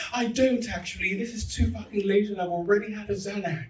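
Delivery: high, loud comic protest; hammed-up exasperation, pitched high; exasperated retort. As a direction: protest loudly back at a rowdy crowd. Acted retort to the screaming crowd.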